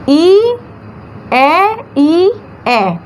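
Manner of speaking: "ई, ए, ई, ए" each held alone, mostly rising; isolated vowel sounds. Shown as a demonstration. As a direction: count out "ई, ए, ई, ए" as separate long sounds. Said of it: "चार syllable दिखाई दे रहे हैं".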